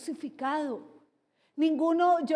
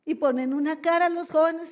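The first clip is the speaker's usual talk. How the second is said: ordinary talk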